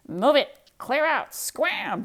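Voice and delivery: loud barking orders; shouting. Acted. The duck yells at the pigs to clear out. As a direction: yell them out of the way. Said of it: "declared the duck".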